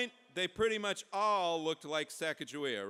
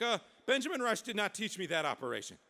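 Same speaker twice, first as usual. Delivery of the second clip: higher, louder mock protest; panicked protest; 5 semitones higher, 5 dB louder than usual. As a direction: protest it in comic alarm.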